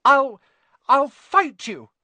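high, loud, stammered threat; worked-up boy daring his friends; defiant schoolboy. A narrator's reading. Neville threatens a fight.

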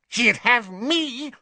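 high, slow fervent witch; passionate. A narrator's reading. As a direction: say it with burning devotion. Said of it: "said Bellatrix passionately".

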